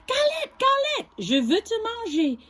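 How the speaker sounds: high hungry wolf call; storybook wolf calling. Acted. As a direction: lure the galette in. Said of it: "dit le loup".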